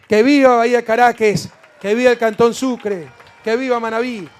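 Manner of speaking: loud rallying cheers to the crowd; booming balcony speech. Acted. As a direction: shout each viva out to the plaza.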